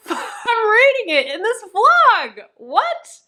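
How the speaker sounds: high, slow, laughing delight; giddy disbelief. Real, not acted. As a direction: laugh through the words, astonished.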